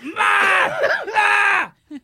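high, yelled "Ma!"; son bellowing Ma. Retold or imitated. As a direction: holler up at mom in exasperation. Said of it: "He's like".

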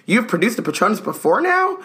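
high, astonished booming question; booming surprise. A narrator's reading. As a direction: boom it out amazed. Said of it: "boomed Amelia Bones".

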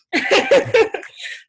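loud, high burst of laughter; real amused laugh. Real, not acted. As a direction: laugh at your own past self. Laughs after admitting past behaviour.

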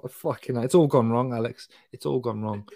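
ordinary delivery

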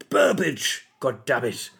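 lightly acted exasperated exclamation; Dad's Army character. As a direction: call out a surname like a sitcom officer. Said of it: "sounds like a character out of Dad's Army".

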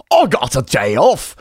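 put-on British accent impression, pitched high; hammed-up heroic rock-singer accent, calling it out. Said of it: "So [name] was like".

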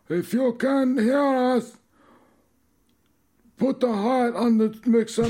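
put-on high character voice with an accent; Pete voice. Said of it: "Pete should come out of retirement".

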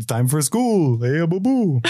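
playful imitation of a cartoon bear; Yogi Bear. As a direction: call it out like a jolly cartoon bear. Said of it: "You would do Yogi Bear impressions?".

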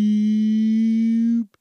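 high, loud electronic beep; high mock laser beep; laser gun beep. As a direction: imitate a ray gun charging up. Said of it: "That's the gun powering up".

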